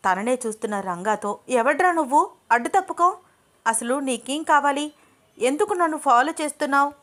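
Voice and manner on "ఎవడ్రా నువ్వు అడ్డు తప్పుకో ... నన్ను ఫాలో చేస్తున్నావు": higher, louder scared challenge; frightened shout. A narrator's reading. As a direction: yell at him to back off, frightened. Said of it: "అని భయంతో చూస్తూ".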